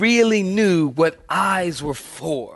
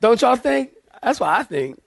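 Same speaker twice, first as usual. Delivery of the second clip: ordinary talk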